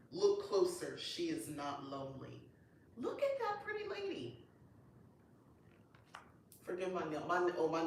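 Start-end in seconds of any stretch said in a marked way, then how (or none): 3.0-4.4 admiring coo. Real, not acted. Admiring a card.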